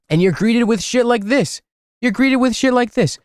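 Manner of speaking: high-pitched, exasperated disbelief; incredulous exasperation. Real, not acted. Streamer to viewers about absurd map entries, incredulous.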